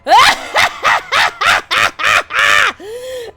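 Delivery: loud, high evil cackle; villain cackle. Acted. Villain laughs after line.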